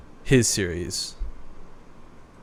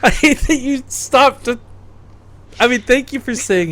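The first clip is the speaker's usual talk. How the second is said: laughing; 12 semitones higher, 7 dB louder than usual. Real, not acted. Laughing at gif pronunciation.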